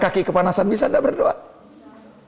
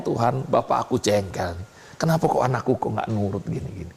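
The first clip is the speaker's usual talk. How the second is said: soft complaining prayer; 7 semitones lower, 5 dB quieter than usual. Acted. Voicing an annoyed parent's prayer.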